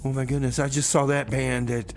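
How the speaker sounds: ordinary talk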